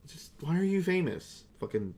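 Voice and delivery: ordinary talk, nothing marked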